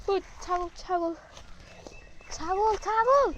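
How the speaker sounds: building call, loudest at the end; calling the goat. Real, not acted. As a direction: keep calling after the goat.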